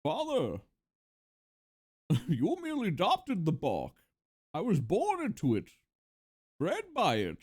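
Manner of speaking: mask-muffled menacing villain speech; Bane impression. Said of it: "he would sound like this".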